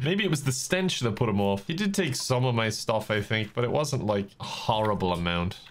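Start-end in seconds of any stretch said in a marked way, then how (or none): none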